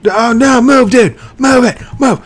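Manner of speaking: high, loud yelling at a mob; frantic yelling. Real, not acted. Shouting at a kid in the way, high and frantic. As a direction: yell "move" over and over, high and loud. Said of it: "That is not my common, usual way".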